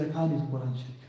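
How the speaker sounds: low, soft retold admission; lightly acted, hushed and low; subdued confession. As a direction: quietly voice a girl's ashamed confession. Tearful girl confesses she can't read.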